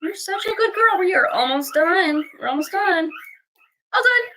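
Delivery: 高音哄小狗. Real, not acted. High baby talk to puppy.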